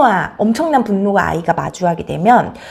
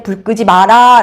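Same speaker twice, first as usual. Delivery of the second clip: dad's warning; 8 dB louder, slower than usual. Acted. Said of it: "저 어둠 속에서 말했을 거예요".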